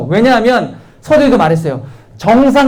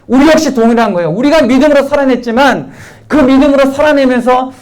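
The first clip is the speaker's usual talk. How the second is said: rally the congregation, pressing each phrase; preaching at full force; 4 semitones higher than usual. Acted. Pastor exhorting the congregation on faith, fervent full-force preaching. Sermon climax, louder and higher.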